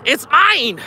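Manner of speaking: high, gleeful exclamation; gleeful claim. Real, not acted. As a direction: crow it out after a laugh. Gleefully claims the boat after laughing.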